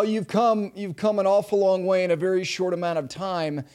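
ordinary talk, nothing marked

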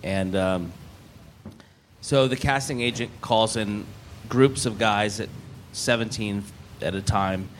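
usual speaking voice

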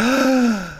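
sharp re-enacted horrified gasp; gasp of terror. Clerk as the empty drawer opens, gasp of terror. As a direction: suck in air in mock terror. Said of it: "this rictus of terror".

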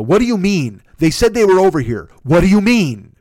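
mock a customer losing patience with the guards; exasperated demand.

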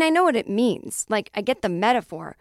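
ordinary talk

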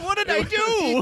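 high, slow plaintive protest; hammed-up, high-pitched bewilderment; mock bewildered victim. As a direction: play a baffled old person being yelled at. Playing the yelled-at old people.

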